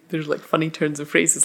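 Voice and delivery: normal talking voice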